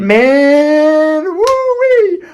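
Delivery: high, loud whoop of joy; excited whoop. Real, not acted. Storyteller whooping to viewers about what he saw, thrilled. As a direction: let it burst out, way up high.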